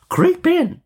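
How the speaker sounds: very high witch screech; coaxing witch. A narrator's reading. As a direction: urge her in with a high witch voice. Witch orders girl into oven.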